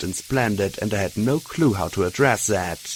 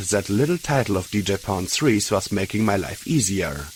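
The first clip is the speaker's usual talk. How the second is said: ordinary delivery, nothing marked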